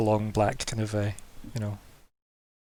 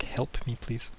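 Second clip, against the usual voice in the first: put-on softer, small pleading girl's voice; quiet plea; 11 dB quieter, slower than usual. The girl quietly begging the madam for help, softer. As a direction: plead under your breath to the madam. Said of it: "looks up at Madame Emerald like".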